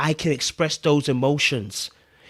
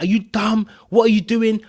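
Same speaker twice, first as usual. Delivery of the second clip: higher-pitched irritated outburst; flipped out; 5 semitones higher than usual. Retold or imitated. Man imagining his younger self reacting to her, flipped out. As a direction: mimic losing your temper, voice raised in pitch. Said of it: "I'd have flipped out".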